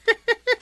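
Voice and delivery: sudden high burst of laughter; a short, high laugh of delight; hearty laugh. Acted. Captain laughs at gift.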